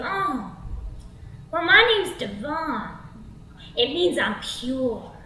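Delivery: slow, self-satisfied drawl; drawn-out smooth-talker voice; swaggering creep. Acted. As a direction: introduce yourself like a slow, cocky flirt. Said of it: "he's sort of swaggering down the street".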